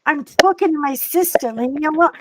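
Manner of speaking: loud mock brush-off; re-enacted annoyed outburst on the phone; acted irritation. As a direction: fend off an interruption, loudly. Acted quarrel over sister phone call.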